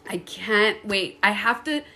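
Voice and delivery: ordinary talk, nothing marked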